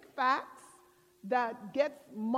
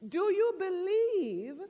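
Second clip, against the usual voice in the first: slow, raised heavenly question; imitating a solemn voice from above; 3 semitones higher, 4 dB louder than usual. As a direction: call down slowly, spacing the phrases. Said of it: "The voice came back and says".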